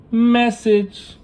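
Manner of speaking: a single word shot out high and loud; emphatic exclamation. Acted. Telling viewers the moral with an emphatic exclamation. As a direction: announce it like a headline. Stressing his spiritual point.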